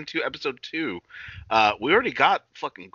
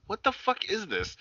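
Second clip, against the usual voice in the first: high, slow, bewildered quote; retelling his confusion at the dance scene; baffled outburst; 9 semitones higher, 6 dB quieter, slower than usual. Retold or imitated. To his cohost, recalling watching the episode, in a high slow bewildered voice. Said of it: "And I'm like".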